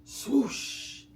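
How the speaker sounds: breathy swoosh of a sled; sled swoosh for a child; whooshing sled sound. Acted. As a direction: make the whoosh of sliding down. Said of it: "He slid down the hill".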